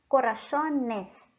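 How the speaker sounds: corazones slowly, "co-ra-SO-nes"; correct plural. Shown as a demonstration. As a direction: stretch corazones, plain "so" without an i-glide. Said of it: "este es el plural correcto".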